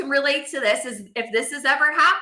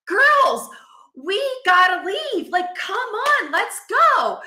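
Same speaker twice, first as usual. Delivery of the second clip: retold yelling; 8 semitones higher, 4 dB louder, slower than usual. Rushing late kids out the door.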